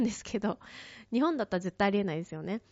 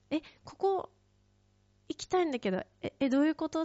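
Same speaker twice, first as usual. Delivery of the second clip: much higher, rising fluster on "え、ここ"; flustered confusion; 8 semitones higher than usual. Retold or imitated. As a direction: sound lost and pleading with the driver. Confused plea to driver.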